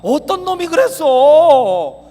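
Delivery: high, loud, drawn-out alarm; hammed-up dad rushing to a crying child; outraged protective father. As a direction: stretch out the indignant questions. Acts father alarmed at child's cry.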